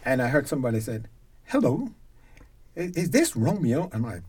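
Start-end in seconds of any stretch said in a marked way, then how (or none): 1.5-3.9 higher, lightly put-on Cockney English; a hint of a Cockney caller; imitated Cockney accent. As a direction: mimic a London caller's Cockney lilt. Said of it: "this is a Cockney accent".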